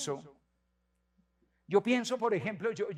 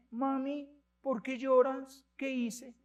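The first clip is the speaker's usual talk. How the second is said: put-on small child; 5 semitones higher, slower than usual. Boy coming home to his crying mother, lightly voiced and higher. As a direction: ask like a worried little boy. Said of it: "y le dice".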